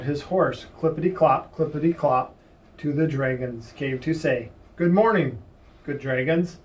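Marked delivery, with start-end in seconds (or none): none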